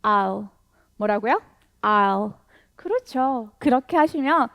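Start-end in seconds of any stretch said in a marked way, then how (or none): 0.0-2.3 I'll said as one syllable, I gliding right into L with no w; I'll, straight to L. Shown as a demonstration. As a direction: drop the w sound entirely. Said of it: "I 한 다음에 L로 바로 가시면 돼요". (w 소리 없이 I에서 L로 바로 넘어가는 I'll)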